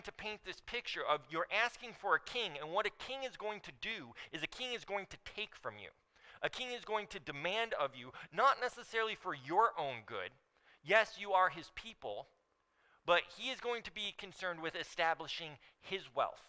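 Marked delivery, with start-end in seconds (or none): none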